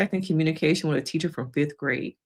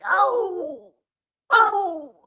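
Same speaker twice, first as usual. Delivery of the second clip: imitated dramatic cry; 13 semitones higher than usual. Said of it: "It was like".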